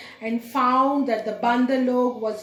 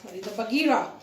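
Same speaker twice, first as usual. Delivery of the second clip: usual speaking voice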